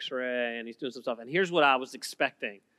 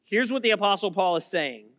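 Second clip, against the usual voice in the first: ordinary talk, nothing marked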